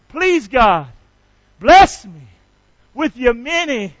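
loud, measured prayer line for the crowd to echo; leading a prayer. Shown as a demonstration. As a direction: call it out slowly so they repeat. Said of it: "Say that with me".

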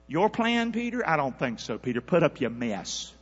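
rising preacher voice giving Jesus's words; preaching. Acted. Preacher to church, voicing Jesus rebuking Peter. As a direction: preach it with heat.

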